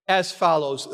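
ordinary talk

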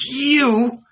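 high, loud cry of disbelief; aghast cry. A narrator's reading. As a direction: cry out, stunned. Paul reacts aghast to Jesus.

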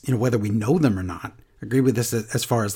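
usual speaking voice

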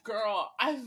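ordinary talk

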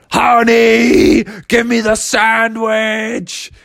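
a hint of a crazed, honey-mad bear; drawn-out craving, slow and hungry. Crazed bears demanding Froggy's sandwich, mildly zombie-like slow voice. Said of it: "they were crazed".

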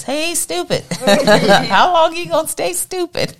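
high, slow mocking preacher voice; Kenneth Copeland. Preacher scolding the foolish, high and slow jeering. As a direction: tease them in a high, drawn-out jeer. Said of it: "Kenneth Copeland says".

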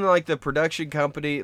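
ordinary talk, nothing marked

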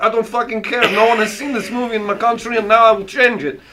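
put-on comic Russian accent, defiant. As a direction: brush off objections in a fake Russian accent. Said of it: "I'll do it as Russian".

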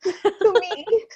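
put-on high, slow, laughing baby voice; baby bewildered. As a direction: voice a stunned newborn with a laugh. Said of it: "she just looks like".

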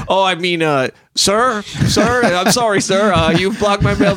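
high, sheepish apology; sheepish backpedal. Retold or imitated. Caught insulting a neighbor, high sheepish apology. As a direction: scramble to take it back politely. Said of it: "That's how I am".

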